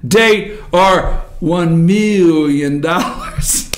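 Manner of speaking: very slow, low, stretched-out figure; drawn-out reveal. Real, not acted. Host reading out the damages claimed, drawn-out reveal. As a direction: savor each word for comic suspense.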